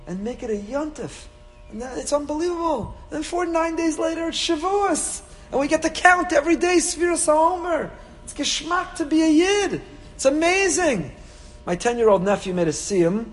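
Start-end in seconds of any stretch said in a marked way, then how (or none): 1.7-11.1 high, lively delight; excited. Real, not acted. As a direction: bubble over with joy. Gushing about Shavuos.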